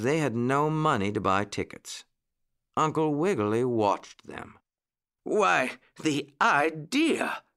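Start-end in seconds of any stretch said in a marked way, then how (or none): 5.2-7.4 higher, drawn-out exclamation of sudden inspiration; pitched-up, stretched-out realisation; struck exclamation. A narrator's reading. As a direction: exclaim it slowly as an idea dawns. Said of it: "exclaimed Mister Longears".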